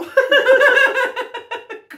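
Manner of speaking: high loud burst of laughter. Real, not acted.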